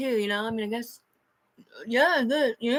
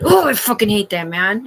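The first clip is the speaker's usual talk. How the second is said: ordinary delivery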